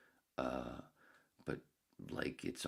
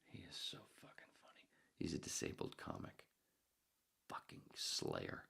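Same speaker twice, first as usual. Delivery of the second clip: admiring whisper; 7 dB quieter than usual. Acted. Whispered praise of comic.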